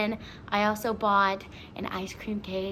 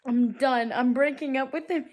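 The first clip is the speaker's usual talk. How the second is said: mock mad; 4 semitones higher, 6 dB louder than usual. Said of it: "she just comes in mad".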